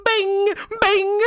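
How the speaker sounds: high, ringing "bing" hit sounds; imitated impact noise. Viewer mimicking the hits in the clip, high imitated noise. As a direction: punch out two high cartoonish dings.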